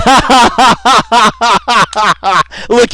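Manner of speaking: long, high, loud run of laughter; loud belly laugh. Real, not acted. Host laughing as the capture scene starts, long loud laugh. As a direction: roar with laughter.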